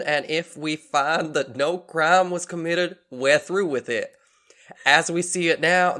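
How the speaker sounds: a southern police lieutenant making a statement; southern accent. Acted. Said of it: "how to do a southern voice".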